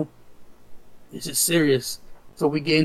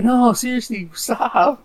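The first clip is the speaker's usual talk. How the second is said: high-pitched mock protest; imitated exasperated plea; 8 semitones higher, slower than usual. Trolled stranger begs the teasing to end, mock exasperated. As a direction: plead like a heckled stranger, voice pitched up.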